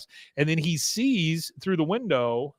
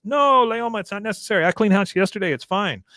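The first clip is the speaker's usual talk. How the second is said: high, raised-voice objection, easing at the end; acted protest; 8 semitones higher, 3 dB louder than usual. Daughter-in-law stops Leoma cleaning the kitchen, acted protest. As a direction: protest high and loud, then settle down.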